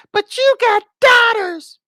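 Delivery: very high, loud falsetto wail; mocking outcry. Streamer imitating people objecting to the post, high mocking shriek. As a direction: squeal it in mock outrage.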